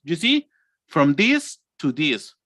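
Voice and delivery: ordinary talk, nothing marked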